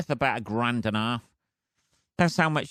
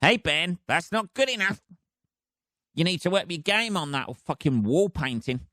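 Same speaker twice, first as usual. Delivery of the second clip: inner voice nagging; 6 semitones higher than usual. Retold or imitated. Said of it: "my brain was going".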